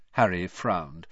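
ordinary delivery

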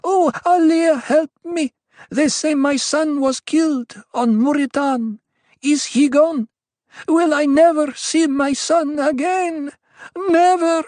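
high, loud pleading wail; distraught mother crying out; anguished cry. A narrator's reading. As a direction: beg the priestess in desperate grief. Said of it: "the woman cried".

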